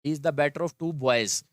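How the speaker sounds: very slow, each word spaced out; slow teacher dictation; slow model sentence. Shown as a demonstration. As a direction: dictate it slowly to the class. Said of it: "एक sentence मैं आपको बताता हूँ".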